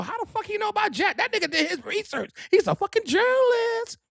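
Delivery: high, squealing [name] impression; imitating [name]. [name] asking how he knows about Jack, high and squealing. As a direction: shriek it like a shocked diva. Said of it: "girl, you gonna know".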